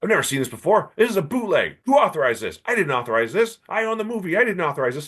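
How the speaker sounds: high-pitched indignant rant; imitating a grumpy old man losing his temper. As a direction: act out the rant of an affronted old producer. Friedman ranting about bootleg.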